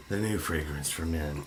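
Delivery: low, husky fragrance-commercial voice; sultry perfume ad. Pretend. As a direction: purr it like a cologne ad. Mock cologne ad after Holiday Inn.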